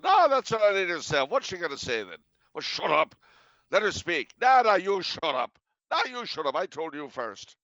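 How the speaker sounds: high-pitched mock bickering; squabbling Irish family. Irish family arguing over who speaks, hammed-up squabble. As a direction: act out relatives talking over each other.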